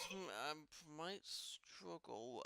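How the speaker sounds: slow, soft hesitant mumble; softly voiced smith, unsure; doubtful blacksmith. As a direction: draw it out, unsure he can do it. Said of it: "He goes".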